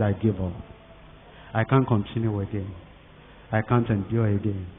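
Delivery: low, soft, worn-out voice of a freezing man giving up; a man at the end of his strength, quietly giving in; defeated surrender. Acted. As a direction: let the words sink, spent and beaten. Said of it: "He told those soldiers, he said".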